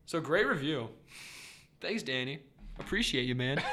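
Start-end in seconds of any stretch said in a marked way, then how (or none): none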